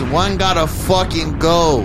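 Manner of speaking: a loud, slow, drawn-out hype call with an English swear in it; loud slow catchphrase. Acted. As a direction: belt out the segment catchphrase, slowly. Hosts' segment catchphrase.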